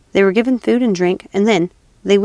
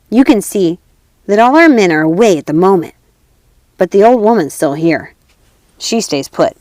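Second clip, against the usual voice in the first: put-on louder conspiring robber girl; 4 dB louder than usual. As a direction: urge it boldly.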